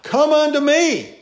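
high, loud summons; crying out. Acted. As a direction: shout the invitation across the street. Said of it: "She cried out".

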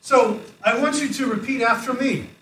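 loud call to repeat. Real, not acted. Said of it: "you guys are just much too quiet".

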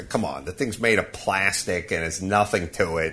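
usual speaking voice

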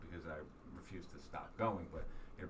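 normal talking voice

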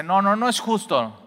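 loud, high outraged complaint; indignant protest. Acted. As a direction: protest loudly like you're being cheated. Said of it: "este siervo no dice".

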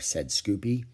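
ordinary delivery, nothing marked

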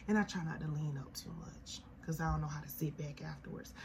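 soft unvoiced confession; whispered aside. Real, not acted. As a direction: hush it as an aside.

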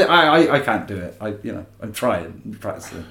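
ordinary talk, nothing marked

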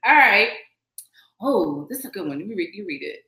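normal talking voice

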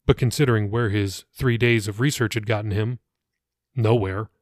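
ordinary delivery

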